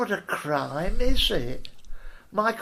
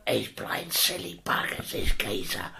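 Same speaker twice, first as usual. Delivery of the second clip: much lower, breathy hard-woman growl; rough whispering dictator; 9 semitones lower, 6 dB quieter than usual. Retold or imitated. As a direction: menace it quietly like a tyrant. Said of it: "she's gonna".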